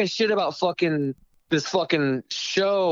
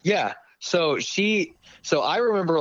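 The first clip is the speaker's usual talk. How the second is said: usual speaking voice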